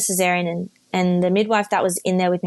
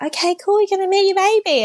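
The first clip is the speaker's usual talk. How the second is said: much higher, chirpy sing-song imitation; mock cheerful staff; 12 semitones higher, 3 dB louder than usual. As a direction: parrot the staff's bright cheer, mockingly. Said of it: "they were like".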